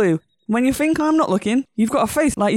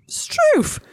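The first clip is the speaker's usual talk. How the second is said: startled. Acted. Startled exclamation in bed scene.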